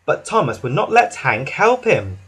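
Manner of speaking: ordinary delivery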